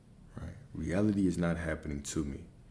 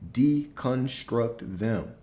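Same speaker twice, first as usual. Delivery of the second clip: very slow, higher-pitched command; with authority; 4 semitones higher, much slower than usual. Real, not acted. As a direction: draw each syllable out as an order. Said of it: "step into these with authority".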